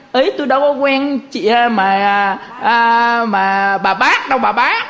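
lightly acted "who are you?" brush-off; mock denial. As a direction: act out brushing off a stranger, voice lifted. Acts out someone denying acquaintance.

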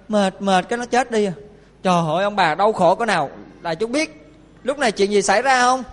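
ordinary delivery

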